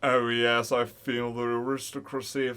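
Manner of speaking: usual speaking voice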